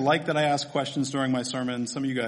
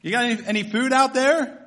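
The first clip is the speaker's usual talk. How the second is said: higher-pitched call, rising at the end; hollering question; 6 semitones higher, 3 dB louder than usual. Acted. Stranger on shore asks the fishermen, mild holler. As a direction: lightly mimic calling out to a boat. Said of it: "hollering at 'em about their fishing trip".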